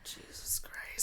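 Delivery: breathy whispered shock; whispering. Real, not acted. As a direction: mouth it in a whisper. Whispered reaction.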